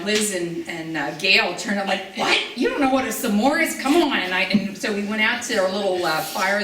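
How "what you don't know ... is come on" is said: higher, louder disbelieving outcry; incredulous. Retold or imitated. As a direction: act shocked that anyone hasn't heard of s'mores. Friends incredulous about s'mores.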